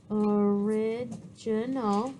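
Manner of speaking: word drawn out syllable by syllable; slow dictation. Real, not acted. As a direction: say it slowly as you write it, pitch dropped. Repeats the word while writing it.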